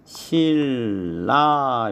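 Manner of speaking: 실라 drawn out with a long double l; teacher demonstrating 실라, both syllables held; liquidized pronunciation. Shown as a demonstration. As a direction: stretch 실라 slowly as "sil-la". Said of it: "그래서 발음을 하면".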